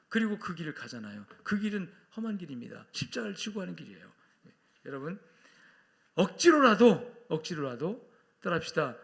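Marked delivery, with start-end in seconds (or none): 6.1-7.2 loud, high, stretched-out exhortation; emphatic call. Shown as a demonstration. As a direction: punch it out slowly at full force. Preacher gives line to repeat. (크고 길게 힘주어 외치며)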